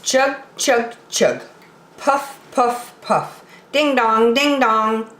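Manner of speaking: chug and ding like a toy train; sing-song train sound effects; playful choo-choo chant for kids; chanted train noises. Acted. Train sounds opening the story.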